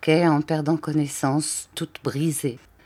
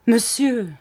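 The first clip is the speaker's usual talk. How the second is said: higher, more urgent plea; rising plea; 6 semitones higher than usual. A narrator's reading. The weeping wife begging her husband, higher and more urgent. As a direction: let the plea climb. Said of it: "elle disait pour toute réponse".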